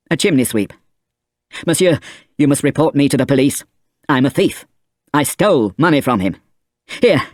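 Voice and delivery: a touch of frantic urgency; desperate confession. A narrator's reading. Valjean pressing coins on the priest, a hint of panic. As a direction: blurt it out anxiously.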